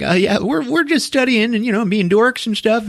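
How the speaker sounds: high, eager mock babble; hammed-up giddy awkwardness; happy to see her. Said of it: "he's all happy to see her".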